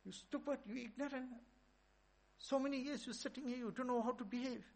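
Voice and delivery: high, loud furious scolding; shrill-pitched shouted insults; screaming rebuke. Retold or imitated. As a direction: berate him like a raging master. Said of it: "he's screaming and shouting".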